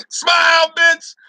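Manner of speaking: loud, high laughing jab; laughing taunt. Acted. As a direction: shout it through a laugh.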